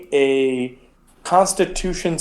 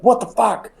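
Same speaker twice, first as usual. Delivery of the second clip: high-pitched startled exclamation; acted shock, pitch jumping up; startled awake; 8 semitones higher than usual. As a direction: jolt awake at a cry in the night. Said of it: "and you're like".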